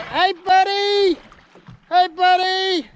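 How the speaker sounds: calling out. Real, not acted. Calling to listening whale.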